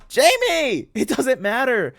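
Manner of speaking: high, loud, drawn-out shout; calling after someone. A narrator's reading. Claire calls after Jamie running to the river, shouting. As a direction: yell to stop someone running off. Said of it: "I call after her".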